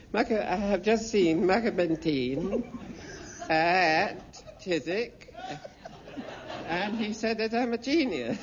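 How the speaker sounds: slow, lightly voiced earnest young man; drawn-out earnest announcement, half laughing; imitating the earnest stranger. Stranger telling Michael he saw Bentine, lightly mimicked, slow. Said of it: "this is how he spoke".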